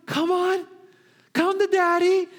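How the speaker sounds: put-on high, sing-song coaxing to a toddler; father coaxing his baby to walk; coaxing daddy. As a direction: lure the baby forward gently. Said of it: "And then you're like".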